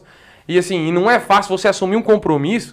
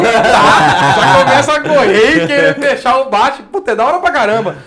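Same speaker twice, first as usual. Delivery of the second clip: laughing; 7 semitones higher, 6 dB louder than usual. Real, not acted. Rindo ao contar.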